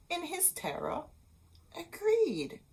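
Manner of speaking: high, soft frightened voice; fearful. A narrator's reading. Narrator telling the husband's terrified yes, quiet. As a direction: say it small and scared.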